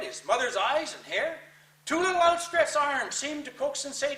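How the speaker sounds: rising frightened intensity. Acted. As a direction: build it up like seeing a ghost. Ghost child appears, rising terror.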